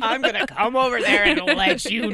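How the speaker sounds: loud, slow mock threat; threatening father's voicemail. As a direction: laughingly act out an angry dad's threat. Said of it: "That voicemail".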